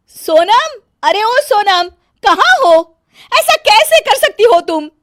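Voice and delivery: bossy bahu hollering for her sister-in-law; calling out angrily. Acted. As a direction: yell for her from another room. Megha shouts for Sonam.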